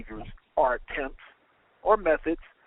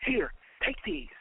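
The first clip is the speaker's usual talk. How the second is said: plain reading voice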